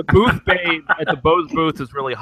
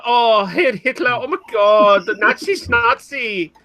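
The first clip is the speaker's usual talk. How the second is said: high-pitched mock German Valley Girl gush; hammed-up German Valley Girl accent; 6 semitones higher, slower than usual. Riffing on the brewer with friends, high German Valley Girl accent. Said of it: "he's a German Valley Girl".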